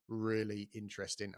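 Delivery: usual speaking voice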